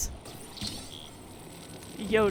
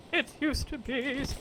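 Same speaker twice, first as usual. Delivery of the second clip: usual speaking voice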